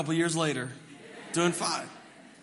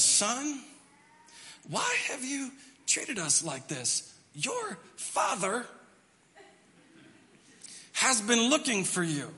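through gritted teeth; 5 semitones higher, slower than usual. Shown as a demonstration. Mary scolding her son in front of church people, through teeth. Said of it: "Through her teeth like this".

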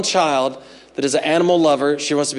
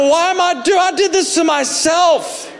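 much higher, half-shouted self-reproach; anguished outburst; 13 semitones higher than usual. Retold or imitated. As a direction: cry out at your own foolishness. Grief breaking at dog funeral.

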